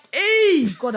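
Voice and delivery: loud, high mock cry to heaven; calling out. Person pleading with God, loud acted call. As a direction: holler it as a sudden appeal.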